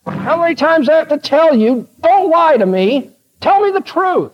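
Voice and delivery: high-pitched acted exasperation; hammed-up royal indignation, pitched high; exasperated demand. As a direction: demand it like a fed-up king. Acted king demanding the truth.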